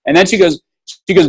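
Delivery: ordinary talk, nothing marked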